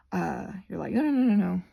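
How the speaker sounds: usual speaking voice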